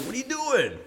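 high, cracking disbelief; retold shocked demand to ex-girlfriend; incredulous confrontation. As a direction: catch her out, voice jumping up high.

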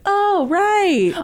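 lightly voiced friend; mock realization. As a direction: imitate her sudden realization. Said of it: "you're like".

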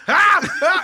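loud, high-pitched yelps of terror; two explosive re-enacted shrieks; panicked scream. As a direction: scream as if your face is burning. Retold panic, still screaming.

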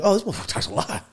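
high, quick imitation of an onlooker; mock surprised complaint. Voicing someone surprised by his chattiness, mock complaint. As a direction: toss it out fast and high-pitched. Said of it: "and then you're like".

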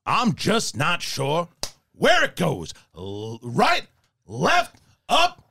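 drawling Southern-accented movie-star impression; Al Pacino. Co-host demonstrates the actor's Southern accent turn, Pacino impression. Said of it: "a Southern accent somewhere".

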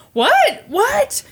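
high, squeaky double what; shocked disbelief. Retold or imitated. Leon being cuffed by police, high shocked yelp. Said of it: "Leon is like".

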